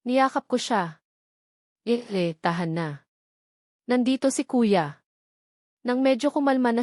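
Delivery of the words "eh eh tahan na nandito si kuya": soothing comfort. Acted. Comforting crying Mika in hug.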